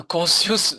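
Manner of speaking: conscious said the French way, kɔ̃sjus; conscious in French-accented English. Shown as a demonstration. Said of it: "avec l'accent français".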